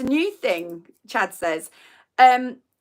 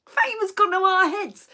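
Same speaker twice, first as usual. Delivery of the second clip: high, posh-sounding self-mocking boast; mock grandiose; 9 semitones higher than usual. Joking to viewers that fame went to their heads, mock grand. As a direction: puff up like a celebrity.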